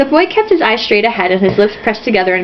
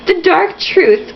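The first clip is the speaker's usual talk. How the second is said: headline voice; 8 semitones higher, much slower than usual. Acted. Reading a headline.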